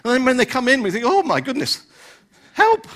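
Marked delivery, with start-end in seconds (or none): 1.1-2.8 hammed-up alarm, leaping high on the last word; mock dismay. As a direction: play an appalled churchgoer's cry for rescue. Acting churchgoers' dismay at newcomers.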